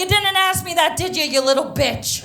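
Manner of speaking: loud, high, combative comeback; mock snarky retort. Traveler talks back to border agent, loud and mock-combative. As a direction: fire back at an imitated opponent.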